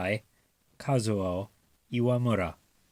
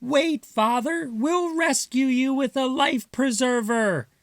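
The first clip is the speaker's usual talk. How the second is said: high squeaky mouse voices, urgent; mice shouting; 13 semitones higher than usual. Acted. Mice promising to rescue Father, high eager squeak. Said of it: "the seven little mice shouted".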